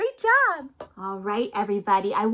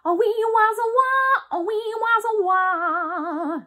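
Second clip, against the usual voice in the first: high wailing guitar imitation; electric guitar noise; 7 semitones higher than usual. Shown as a demonstration. Teacher to kids, imitating an electric guitar up high. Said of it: "make that noise with me".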